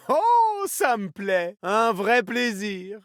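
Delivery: high, drawn-out delight. Acted. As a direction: savour it with a long high "oh". Delighted reaction in game. (ravi, voix aiguë et traînante)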